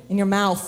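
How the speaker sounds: "mouth" said as "mouf". Shown as a demonstration. Showing the crowd the threat, "mouth" pronounced "mouf". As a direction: end "mouth" on an F sound. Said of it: "You gotta use the F".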